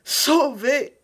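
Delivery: high, loud cry of relief; elated cry. A narrator's reading. Milady just freed by Felton, crying out in relief. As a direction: cry out in joyful deliverance. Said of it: "s'écria-t-elle".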